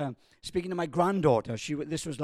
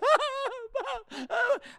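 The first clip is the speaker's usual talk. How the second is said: high wailing imitation of a child sobbing; mock hysterical crying. Granddaughter bursts into tears, high mock wail. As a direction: fake a little girl's hysterical wail. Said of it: "starts crying hysterical".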